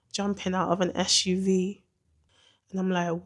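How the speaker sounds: ordinary delivery, nothing marked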